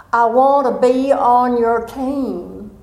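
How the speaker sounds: put-on slow, drawn-out Southern accent. Nephew approaching the cycling coach, Southern accent. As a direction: draw every word out in a Southern accent. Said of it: "in his southern accent".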